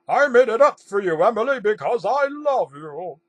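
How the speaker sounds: loud, high, hammed-up love confession; impassioned declaration. A narrator's reading. Old intern confesses his love to Emily, impassioned declaration.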